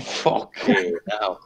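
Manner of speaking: slow, voiceless gasp of retold amazement; hushed disbelief. Friend hearing the psychic sees everything, hushed disbelief. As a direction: draw it out in a stunned whisper. Retelling friend's stunned reaction.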